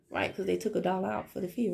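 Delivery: usual speaking voice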